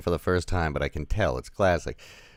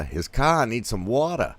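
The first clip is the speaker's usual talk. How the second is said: slightly louder, higher Boston guy; Boston-accented delivery, pitched up a little; 6 semitones higher, 5 dB louder than usual. Acted. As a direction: slip into a Boston guy's accent. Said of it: "said that like a Boston guy".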